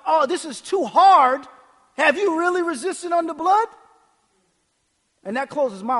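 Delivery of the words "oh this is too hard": slow, loud mock complaint; lightly hammed-up moaning; mock whining. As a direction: drag out the complaint, imitating a whiner. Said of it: "When you're whining about".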